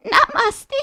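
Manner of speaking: high thankful goat voice; grateful goat. Acted. Goat says thank you.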